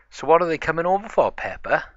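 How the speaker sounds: high, loud and quick excitement; bubbling over with eagerness; fast, high, loud excited question. Acted.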